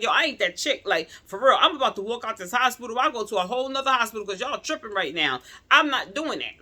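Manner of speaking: loud, high and fast; heated loud threat to leave; retold angry outburst. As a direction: rant about walking out of the hospital. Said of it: "I got mad. I was pissed".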